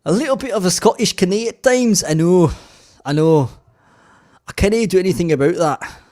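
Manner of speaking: loud, high mock Scottish; Scottish accent. Shown as a demonstration. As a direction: belt it out in a hammed-up Scottish accent. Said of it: "Little Scottish".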